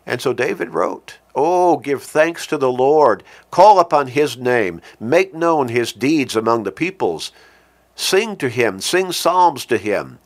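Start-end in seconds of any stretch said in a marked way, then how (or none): 1.4-10.2 fervent scripture reading. Acted. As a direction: proclaim it to the congregation. Reads David's psalm aloud.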